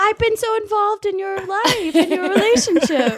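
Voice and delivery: high, building protest; indignant insistence. Acted. Tangerine demanding to officiate the wedding, indignant. Acted quarrel, Tangerine argues back.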